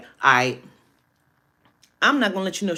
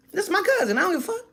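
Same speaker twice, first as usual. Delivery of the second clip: normal talking voice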